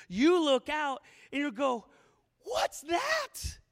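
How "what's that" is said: high, loud startled shout; terrified cry. Acted. Rower in the storm sees a figure, terrified shout. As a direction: cry out in alarm at the sight. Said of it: "Of course they're terrified".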